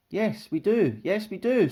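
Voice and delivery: high, eager bird squawk. A narrator's reading. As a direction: squawk it like excitable birds. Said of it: "squawked the rainbow birds".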